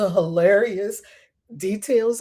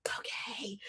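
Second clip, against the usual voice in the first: quiet scribe; 19 dB quieter than usual. Retold or imitated. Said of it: "They were like".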